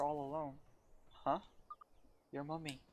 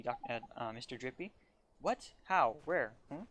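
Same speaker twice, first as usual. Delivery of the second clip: ordinary talk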